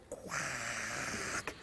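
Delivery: voiceless, whispered quack; barely audible whispered quack; tiny duck quack. Acted. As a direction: let out a quack on breath alone. Fourth duck's barely audible quack.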